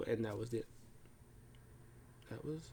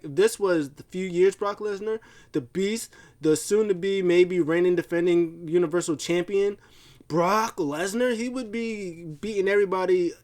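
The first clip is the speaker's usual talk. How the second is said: indignant hype; 5 semitones higher, 3 dB louder than usual. Retold or imitated. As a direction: mildly imitate a manager hyping his fighter. Acting out Heyman's indignant hype.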